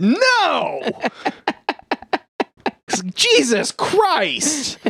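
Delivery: very high drawn-out mock outcry; overacted high-pitched exclamation, slow on Jesus Christ; hammed-up exclamation. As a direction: stretch the cry out in a put-on voice. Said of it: "And he's like".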